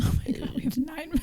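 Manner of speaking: quick hushed aside; real urgent whisper. Real, not acted. Co-host warning that nine minutes are left, whispering. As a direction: whisper it fast to your co-host.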